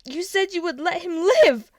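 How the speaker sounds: high, loud betrayed shout. A narrator's reading. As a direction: accuse your father of lying. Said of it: "Peter shouted".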